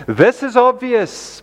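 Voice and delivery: usual speaking voice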